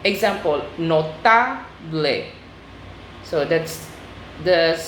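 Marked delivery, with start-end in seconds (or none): 0.8-2.2 "notable" drawn out slowly, no-TA-ble, weight on "ta"; next-to-last stress. Shown as a demonstration. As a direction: slowly sound out Spanish "notable", stressing the middle "ta". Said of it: "stressed on the next to the last syllable".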